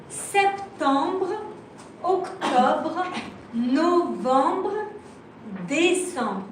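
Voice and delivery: "septembre, octobre, novembre, décembre" spaced out with French R endings; French pronunciation. Shown as a demonstration. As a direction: model each month in French, "septembre" to "décembre", pausing between. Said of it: "In French, it will be R E".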